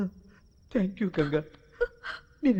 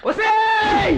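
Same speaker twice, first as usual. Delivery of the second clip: sharp, loud yell, pitched high; angry shout; 11 semitones higher, 5 dB louder, much slower than usual. Acted. Man yelling at the taunting woman, angry shout. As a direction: bark it out to stop her cold.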